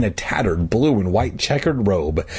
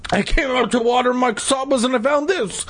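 high-pitched, overwrought wail; hammed-up distress rising to a wail; too upset to finish; 14 semitones higher than usual. A narrator's reading. Mister McCall showing his spoiled Casabas, too upset to finish. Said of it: "too upset to finish".

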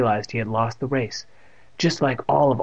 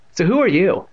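high, louder pointed question; demanding; 7 semitones higher, 5 dB louder than usual. A narrator's reading. Girl questions the new boy, demanding. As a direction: press for an answer in a raised voice. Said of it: "she demanded again".

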